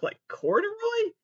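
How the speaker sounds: jumps high in disbelief; high incredulous question. Real, not acted. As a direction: squeak the word corduroy in dismay. Mock horror at fabric.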